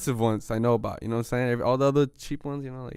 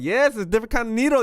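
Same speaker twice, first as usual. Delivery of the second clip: much higher, defensive; defensive reply; 9 semitones higher, 4 dB louder than usual. Retold or imitated. Retelling his defensive answer to the doctor, higher. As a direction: insist it's different. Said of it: "I'm like".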